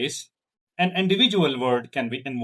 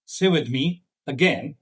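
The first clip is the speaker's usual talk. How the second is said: ordinary talk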